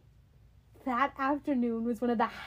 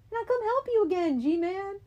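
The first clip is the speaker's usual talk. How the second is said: eager promise; 9 semitones higher, 4 dB louder than usual. Acted. Percy loyal to Grover.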